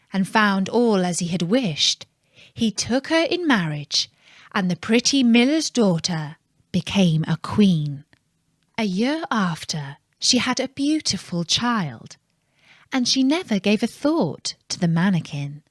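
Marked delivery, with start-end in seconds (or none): none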